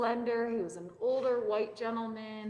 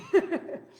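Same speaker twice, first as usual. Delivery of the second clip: burst of laughter; 7 semitones higher, 7 dB louder than usual. Real, not acted. Laughing at own joke.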